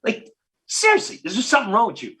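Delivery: high, indignant disbelief, pitch shooting up; incredulous outburst. Retold or imitated. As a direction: scoff at the idea of quitting. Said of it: "I went".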